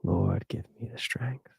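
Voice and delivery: whispered plea for patience; praying for patience through teeth. Pretend. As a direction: whisper it like a strained prayer. Exasperated prayer for patience.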